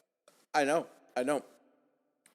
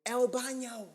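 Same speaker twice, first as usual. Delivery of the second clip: put-on high-pitched American-accented Spanish; 10 semitones higher, slower than usual. Customer asking waiter for the toilet, high American-accented Spanish. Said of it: "in an American accent".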